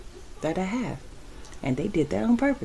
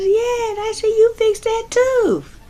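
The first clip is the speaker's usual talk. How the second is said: put-on higher, louder friendly young man; young clerk; 13 semitones higher, 6 dB louder than usual. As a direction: imitate the kid's upbeat compliment. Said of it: "He goes".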